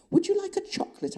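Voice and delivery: high, coy sing-song offer; flirtatious. A narrator's reading. Woman offering her pursuer a chocolate, flirtatious. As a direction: tempt him sweetly and playfully. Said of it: "my flirtatious tone and manner".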